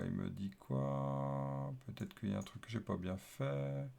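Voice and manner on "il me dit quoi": drawn out and dropped softly, wondering; slow puzzled murmur. Real, not acted. Developer squinting at his app's error, slow puzzled murmur. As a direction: stretch each word as if squinting at the screen.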